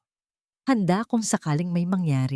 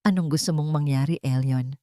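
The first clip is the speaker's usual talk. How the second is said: plain reading voice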